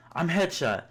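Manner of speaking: high, panicked cry; shriek. Acted. Boy number two gets shot and shrieks, high panicked cry.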